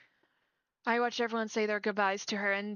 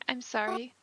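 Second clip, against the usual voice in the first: ordinary talk, nothing marked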